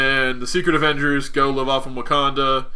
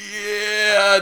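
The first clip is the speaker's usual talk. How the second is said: high, long-held yeah; mock reluctant confession; 7 semitones higher than usual. As Cap owning up to Iron Man, drawn-out mock admission. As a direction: draw it out high, hammed-up.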